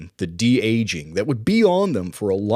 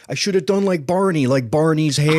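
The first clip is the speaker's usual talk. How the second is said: mock-indignant, higher and louder quote; quoting a comedian's line; 7 semitones higher, 5 dB louder than usual. Imitating a film character for the cohost, higher exclaiming voice. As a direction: do the movie line, pitched up. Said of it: "when he's like".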